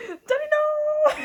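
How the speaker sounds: loud, very high, drawn-out mock sob; Will. Imitating a Fresh Prince of Bel-Air scene, hammed-up crying. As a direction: wail it in hammed-up anguish, stretching the last word. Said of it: "when Will's just like".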